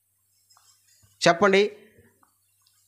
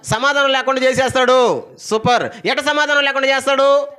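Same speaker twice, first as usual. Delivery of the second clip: higher, driving preacher's cadence; fiery preaching; 8 semitones higher, 3 dB louder than usual. Acted. Preacher describing family strife to the congregation, in a high driving preaching voice. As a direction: rattle off the family quarrels, pulpit style.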